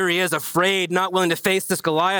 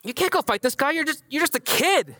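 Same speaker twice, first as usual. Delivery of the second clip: incredulous king; 7 semitones higher than usual. Retold or imitated. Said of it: "Saul looks at David and says".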